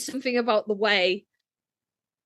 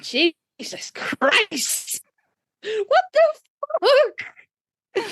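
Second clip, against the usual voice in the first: mock exasperation; 12 semitones higher, much slower than usual. Mock outrage at geography lesson.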